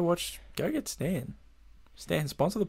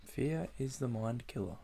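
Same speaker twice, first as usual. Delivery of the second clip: very soft, under the breath; hushed pondering; 14 dB quieter than usual. Acted. Co-host mulling over the Dune quote, hushed.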